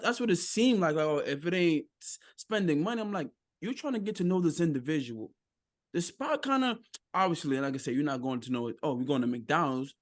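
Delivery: normal talking voice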